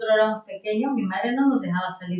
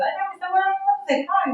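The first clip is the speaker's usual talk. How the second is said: put-on much higher, whiny child voice; higher child's complaint about mom; childish complaint; 10 semitones higher, faster than usual. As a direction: whine like a kid denied playtime. Child self complaining about mother.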